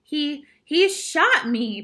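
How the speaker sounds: stammered, high, slow disbelief; shocked voice. A narrator's reading. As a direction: say it haltingly, stunned and loud. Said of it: "in a shocked voice".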